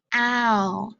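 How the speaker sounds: "ow" as in cow, one clear glide; keyword sound demo. Shown as a demonstration. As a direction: model the "ow" sound for the class.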